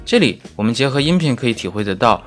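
normal talking voice

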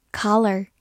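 "collar" in American English, open "KAH-ler" with an r at the end; American pronunciation. Shown as a demonstration. Teacher contrasts with the American pronunciation of "collar". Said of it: "或者美式发音".

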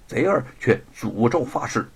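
ordinary delivery, nothing marked

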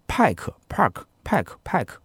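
Pike said with a long "ai" vowel, unlike Park with its "ar" vowel; correct pronunciation. Retold or imitated. Quoting a listener who corrects the host, saying Pike slowly and carefully. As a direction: repeat Pike carefully, setting it against Park. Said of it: "应该读Pike".

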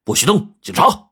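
high, forceful police challenge; police officer's urgent yell; sudden bellow. A narrator's reading. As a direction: shout it as a sharp command. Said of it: "突然传来了一声大喝".

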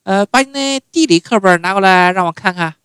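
high, loud mother calling her son. Retold or imitated. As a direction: call out like mom from her room. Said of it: "要不就是".

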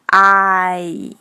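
long held "I" gliding ah to ee; sustained vowel demo on "I"; diphthong. Shown as a demonstration. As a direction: stretch "I" and close it slowly. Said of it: "双元音和前面的eyes是一样的".

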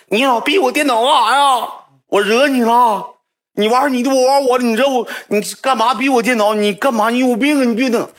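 put-on high, loud scolding girl voice; mock angry girl. Girl yelling at the boy beside her, high and angry. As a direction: scold him in a high, shrill-pitched angry rant.

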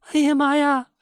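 sudden high shriek of terror; frightened cry. A narrator's reading. Young wife finding her husband cold, shrieking in fright. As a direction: yelp as the cold body is felt. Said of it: "这下可把他给吓坏了".

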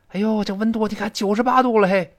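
high exclamation at the heat; theatrical astonished complaint; acted dismay. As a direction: exclaim in amazement, pitched well up. Acts out translated line in dismay.

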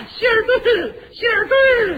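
high, loud shrieking bird call; squawking bird cry. Acted. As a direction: screech the brand like a mynah. Bird demands foreign cigarettes. (尖叫的八哥声)

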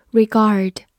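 ordinary talk, nothing marked

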